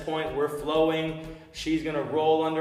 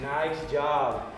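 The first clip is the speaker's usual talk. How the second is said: normal talking voice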